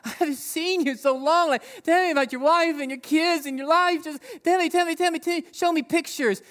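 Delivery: high, eager gushing at a long-lost friend; excited reunion. Retold or imitated. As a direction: gush like greeting an old friend, begging for news. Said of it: "when you see a long-lost friend and you're like".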